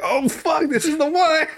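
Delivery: high-pitched, mock-alarmed outburst; mock panic. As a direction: panic as if this pain is the fatal one. Acting out sudden chest-pain panic.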